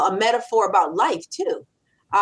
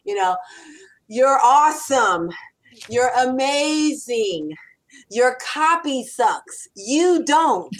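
mantra recital; 3 semitones higher, much slower than usual. Shown as a demonstration. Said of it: "I say, Listen, repeat after me".

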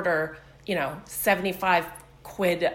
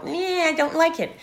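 high-pitched mock kid's refusal; put-on whine, pitched way up; whiny child; 11 semitones higher than usual. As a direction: imitate a fussy child turning down dinner. Voices fussy children refusing food.

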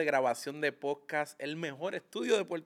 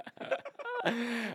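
high laugh; 10 semitones higher than usual. Real, not acted. Guest laughs at the gift.

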